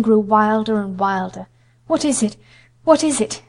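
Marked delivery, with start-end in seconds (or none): 1.9-2.4 a startled, frightened question; gasped in alarm. Acted. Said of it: "Missus Lennox gasped". 2.8-3.4 higher, more urgent frightened question; more panicked gasp. Acted. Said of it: "Missus Lennox gasped".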